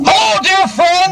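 garbage can echo. Acted. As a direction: greet the audience grandly from inside a can. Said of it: "talking into this garbage can".

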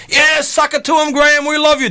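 high-pitched imitated crowd shout; cheering crowd. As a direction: whoop like an adoring fan in the audience. Crowd cheering the promoter's promise.

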